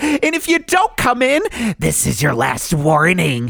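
high, shrill-free squeaky cheerful pitchman going menacing; Chucky voice. Acted. Radio host doing Chucky for a frame shop ad. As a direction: play a creepy mascot chirping his sales pitch. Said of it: "let me just do this as Chucky".

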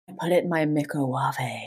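low, soft sultry voice; Nigella. Retold or imitated. Showing co-hosts how the TV cook says it, sultry voice. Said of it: "like, a really sultry voice".

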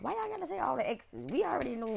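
high, fast mock complaint; exasperated tease. Real, not acted. To fans stuttering the name, teasing and exasperated. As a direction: roll your eyes at them.

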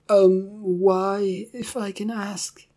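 thin, strangled squeak. Acted. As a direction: squeeze the words out nervously. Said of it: "he managed to squeak out".

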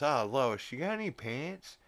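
loud call, rising high on the question; mock yell up the stairs to his wife; yelling upstairs. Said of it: "he yells up to Lois".

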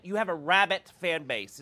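"rabbit" with a clear short i; "rabbit" pronounced as the animal; rabbit said clearly. Shown as a demonstration. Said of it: "All right, I'll say rabbit".

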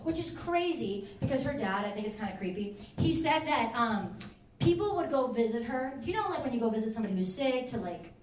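ordinary talk

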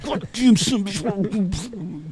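high, drawn-out mock curse of pain; mock cussing. Dad cussing after his son hits his forearm, high mock curse. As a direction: yelp the curse out slow and high. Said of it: "cussing and shit".

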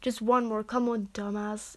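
ordinary delivery

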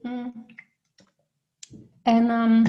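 just swallowed a sip of water; while sipping water. Real, not acted. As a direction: speak right after a sip of water. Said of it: "ik nam even een slokje water hoor".

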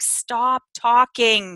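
stern inner voice. Retold or imitated. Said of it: "I can hear in my head".

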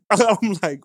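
ordinary talk

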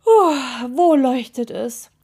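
loud yawn into a slow question. A narrator's reading. Sleepy witch asking the toe about the glow, yawning. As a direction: yawn through the words, drawn out. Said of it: "Miep Miep gähnte".

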